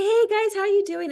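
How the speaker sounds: put-on high-pitched, sing-out hello to the kids; cheery greeting. Mom calling to her boys upstairs, cheery high greeting. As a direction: retell a chirpy check-in, pitched well up.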